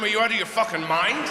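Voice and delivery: ordinary talk, nothing marked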